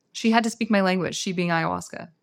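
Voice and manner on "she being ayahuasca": ordinary talk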